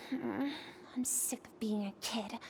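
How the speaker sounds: low, soft frustrated whisper. Acted. Restless girl to herself, soft low whisper. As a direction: grumble it under your breath. Dreaming alone under the stars.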